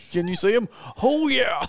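high excited squeal; squealing Oh, yeah, high and giddy. Retold or imitated. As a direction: mimic the thrilled drone pilot. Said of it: "You got real excited flying the drone".

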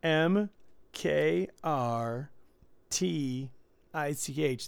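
letters read one by one, slowly; spelling it out. Shown as a demonstration. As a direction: spell out each letter with pauses. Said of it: "I'm gonna spell it for you".

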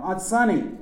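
high, loud call for attention; shouting. A narrator's reading. Nephew to aunt in danger, shouting. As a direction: call out to someone far off. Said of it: "I shouted".

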